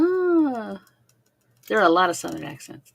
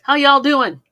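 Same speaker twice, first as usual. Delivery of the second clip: cheery greeting; 7 semitones higher, 11 dB louder, much slower than usual. Real, not acted. Greeting viewers with y'all.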